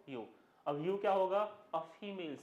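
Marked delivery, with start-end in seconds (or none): 0.1-0.3 यू just like the word "you"; one syllable यू, long oo; pronunciation repeated. Shown as a demonstration. Teacher asks what it is called, answers यू like "you". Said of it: "क्या बोलते हैं इसको".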